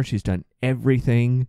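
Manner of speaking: ordinary talk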